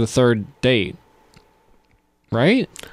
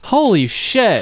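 high, slow stunned exclamation; shocked; 7 semitones higher, much slower than usual. Real, not acted. Host reacts to hearing of a kiss before dating, high and slow in shock. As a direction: let the shock stretch out.

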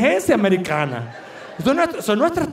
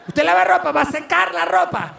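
frantic explaining; 6 semitones higher than usual. Retold or imitated. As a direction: push it up, desperate to be understood. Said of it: "mostrarle al señor".

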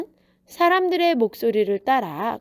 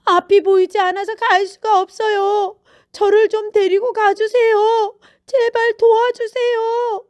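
high, loud desperate begging; acted tearful shouting for help; shouted plea; 11 semitones higher, 7 dB louder than usual. A narrator's reading. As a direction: cry out for someone to lead you. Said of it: "또 소리쳤어요".

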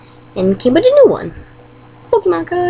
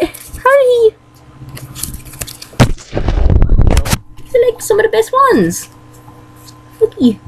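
high, stammering amazement; can't believe what he's seeing, voice jumping up; stunned disbelief; 12 semitones higher than usual. Acted. Mario gapes at the rare cards, high and stunned.

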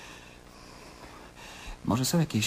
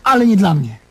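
firm retort; 2 semitones higher, 8 dB louder than usual. Acted. Man retorts in argument.

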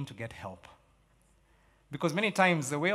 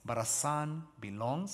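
ordinary talk

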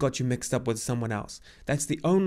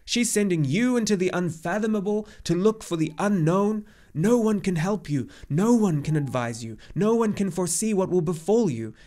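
loud, high, agitated tirade; indignant cry; 6 semitones higher, 5 dB louder than usual. A narrator's reading. Old centaur urging the boy to decide at once, indignant and overwrought. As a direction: press urgently, peaking then tiring slightly. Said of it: "cried Chiron indignantly".

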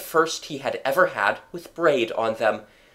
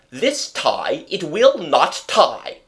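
louder and slow, exasperated; angry father; 4 semitones higher, 4 dB louder, slower than usual. A narrator's reading. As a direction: fume over the stubborn tie. Father fuming over tie.

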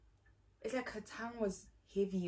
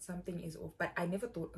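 ordinary talk, nothing marked

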